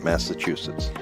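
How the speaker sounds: usual speaking voice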